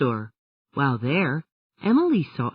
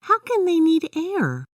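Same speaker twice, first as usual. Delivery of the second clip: Emily's voice. A narrator's reading. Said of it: "said Emily".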